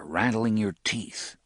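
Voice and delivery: usual speaking voice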